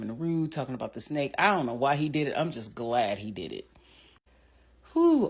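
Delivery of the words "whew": relieved whew. Real, not acted. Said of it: "Okay, and then this scene".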